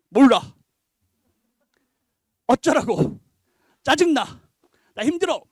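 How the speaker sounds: loud, curt mock-sulky retorts; irritated teen. Child answers parents asking about their day, loudly irritated. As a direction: imitate a fed-up kid snapping back loudly.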